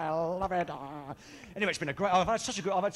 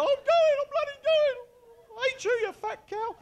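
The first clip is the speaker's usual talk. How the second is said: high, loud crying protest, slowing; bawling child; 4 dB louder, slower than usual. Retold or imitated. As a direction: wail it like a furious kid. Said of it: "And you go".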